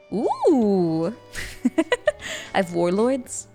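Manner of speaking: ordinary talk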